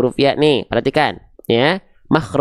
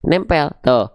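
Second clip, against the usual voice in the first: ordinary talk, nothing marked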